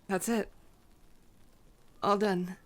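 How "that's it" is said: ordinary talk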